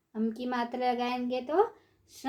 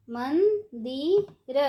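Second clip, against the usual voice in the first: मंदिर stretched slowly, syllable by syllable; slow syllable drill; much slower than usual. Shown as a demonstration. Teacher drawing out मंदिर slowly for children. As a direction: draw out मंदिर for the class. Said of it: "ऊंची आवाज़ में कैसे पढ़ेंगे".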